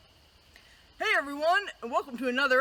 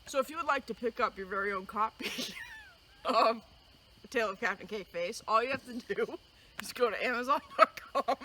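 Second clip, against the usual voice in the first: quick, softer plug, rattled off; fast book plug; 5 semitones lower, 9 dB quieter, faster than usual. Real, not acted. Host plugging her book to kids, fast and softer. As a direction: rush through the ad part.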